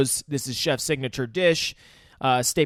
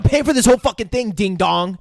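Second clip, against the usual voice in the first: fast, high, loud retort; hammed-up shouted insult; mock angry rant; 6 semitones higher, 6 dB louder than usual. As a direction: imitate an indignant diner telling off his date. Acted quarrel, diner snapping at date.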